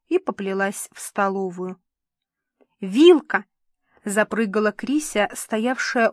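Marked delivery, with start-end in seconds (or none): 2.8-3.5 loud, gleeful burst; excited child's exclamation. A narrator's reading. Little Krisya jumps by the dresser, bursting with excitement. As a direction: burst out like a thrilled little girl.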